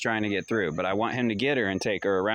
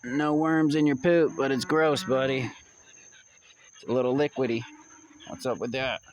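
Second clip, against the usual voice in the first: higher, sing-song pet talk; talking to the dog; 5 semitones higher than usual. Real, not acted. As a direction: coo at your dog about its poop.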